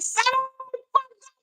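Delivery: squeaky high voice; high-pitched quote. Retold or imitated. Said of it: "Sammy was like".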